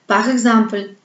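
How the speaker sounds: normal talking voice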